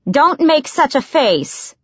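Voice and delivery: high, drawn-out calling over the shoulder; calling back. A narrator's reading. Servant leaving the room teases the assassin, calling back. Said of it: "called over her shoulder".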